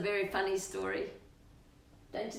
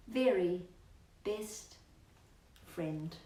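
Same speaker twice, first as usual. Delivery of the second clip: put-on reading to children; 4 semitones higher, 5 dB louder than usual. Ending the story for Rocky, slowing and softening. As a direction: rhyme slowing and softening to a tender close. Reading rhyming picture book aloud.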